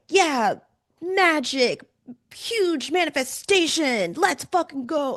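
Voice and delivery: high-pitched, drawn-out hype cheer; mock hype. Coach showing the energy she isn't feeling today, mock hype. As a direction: play up huge excitement you don't feel. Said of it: "I'm not feeling the same".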